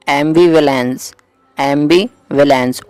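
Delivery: ambivalence spelled out in very slow syllables; slow clear dictation. Shown as a demonstration. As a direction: say ambivalence slowly, like teaching it to a class. Teacher introduces a new word.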